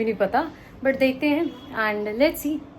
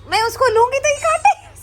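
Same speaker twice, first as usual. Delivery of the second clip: high, loud half laugh half yelp; laughing cry; 13 semitones higher, 8 dB louder than usual. Real, not acted. Owner yelping and laughing as the puppy bites.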